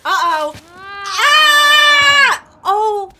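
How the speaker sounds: panicked high scream; screaming while falling. Real, not acted. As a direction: yell as you drop. Falling off the climb.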